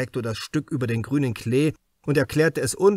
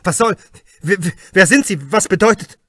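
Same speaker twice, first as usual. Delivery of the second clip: hoarse, louder and higher, words breaking out; hoarse outburst; 7 semitones higher, 11 dB louder than usual. A narrator's reading. Hartwig, unmasked, gasps at Krause, hoarse and loud. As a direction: choke the questions out in alarm. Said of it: "Heiser kam es aus seiner Kehle".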